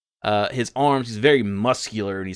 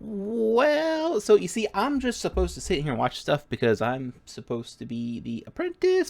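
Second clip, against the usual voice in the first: put-on higher kid voice explaining himself; child apprentice; 8 semitones higher than usual. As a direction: ramble like a bored young apprentice.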